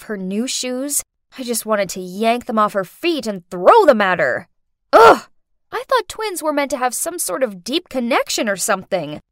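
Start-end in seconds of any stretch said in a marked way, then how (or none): 4.9-5.3 loud, high groan of disgust; exasperated groan. Acted. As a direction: let out a loud, frustrated ugh. Fed up with twin's new shoes.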